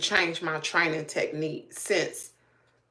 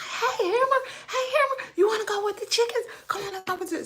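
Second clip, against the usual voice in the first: much higher, sing-song call to a puppy; excited coaxing; faster than usual. Retold or imitated. Calling the puppy out of the drainage ditch, excited coaxing. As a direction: coax the dog out, bright and eager. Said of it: "so I'm getting excited".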